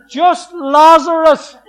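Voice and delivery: "Lazarus" drawn out slowly, loud and high; loud slow call. Shown as a demonstration. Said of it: "He had to say".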